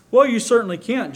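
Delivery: emphatic. A narrator's reading. John agreeing with Mister Davis, loud and emphatic. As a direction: insist on it with full force. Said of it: "John explained emphatically".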